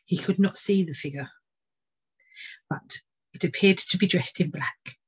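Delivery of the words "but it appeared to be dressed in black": usual speaking voice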